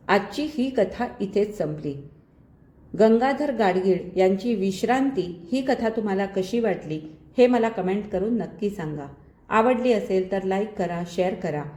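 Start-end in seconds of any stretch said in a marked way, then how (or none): none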